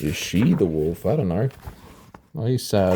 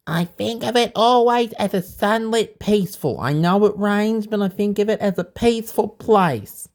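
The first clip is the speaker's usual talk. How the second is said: put-on a child explaining earnestly in a high voice; 11 semitones higher than usual. A narrator's reading. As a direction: speak up high like a little kid. Tal's thought voiced as child.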